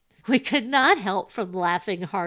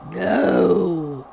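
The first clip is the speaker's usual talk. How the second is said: loud, drawn-out spectral voice; theatrical phantom pronouncement; ghostly spirit; 10 dB louder than usual. A narrator's reading. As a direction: intone it like a booming ghost.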